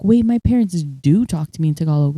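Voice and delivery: ordinary talk, nothing marked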